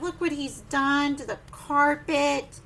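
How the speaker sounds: ordinary delivery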